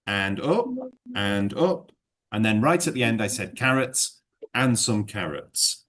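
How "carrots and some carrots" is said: teacher repeating the closing fall; falling tune. Shown as a demonstration. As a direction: land the list's end with a drop. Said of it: "and I went down".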